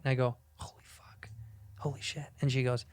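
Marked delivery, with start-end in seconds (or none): 0.6-2.3 hushed, breathy awe on spotting someone famous; stunned whisper. Retold or imitated. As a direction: whisper it in starstruck disbelief. Stunned hushed reaction to a celebrity.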